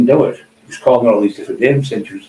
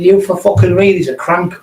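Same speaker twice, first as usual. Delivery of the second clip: retold outburst; 7 semitones higher than usual. Telling a scared friend the rival is a crank, raised scoff. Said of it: "I said".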